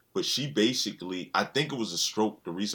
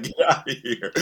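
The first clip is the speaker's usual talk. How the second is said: acting out Mike; 4 semitones higher, 5 dB louder than usual. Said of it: "Mike was like".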